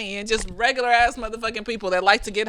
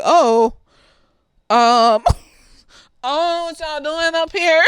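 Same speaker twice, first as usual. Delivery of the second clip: higher, half-laughing "what's going on here" surprise; caught-off-guard surprise; 6 semitones higher, slower than usual. Retold or imitated. Quentin walking in on the kids, amused surprise. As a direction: play a dad stumbling on a secret, amused.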